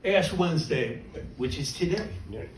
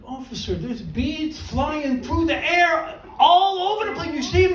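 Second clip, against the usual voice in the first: incredulous protest; 6 semitones higher than usual. Retold or imitated. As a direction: argue with the cop, pitch climbing. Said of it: "I'm like".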